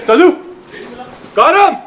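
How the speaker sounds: triumphant yell, high and loud; excited shout. Real, not acted. Shouting a hit in game.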